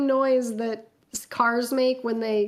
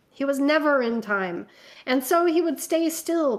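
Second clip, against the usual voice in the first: measured, dramatic fanfic reading; story narration. A narrator's reading. Reading a fanfic aloud to friends, dramatic narration.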